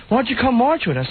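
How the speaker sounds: inviting guy voice. Retold or imitated. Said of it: "He goes".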